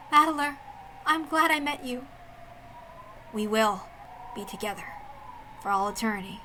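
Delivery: soft, fading loving goodbye; tender farewell. Acted. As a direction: let it sink to almost nothing. Lovers' farewell before final shot.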